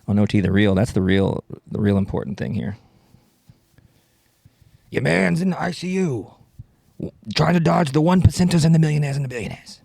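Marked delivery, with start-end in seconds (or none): 4.9-9.8 hammed-up political rant in a rap cadence; Bernie Sanders. Rapping politician railing at billionaires, hammed-up impression. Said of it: "All right, rapping Bernie Sanders".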